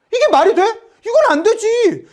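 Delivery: high-pitched indignant arguing; acted vehement refusal; forceful protest. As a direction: object hotly as an outraged negotiator. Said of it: "아주 강력하게 주장을 합니다".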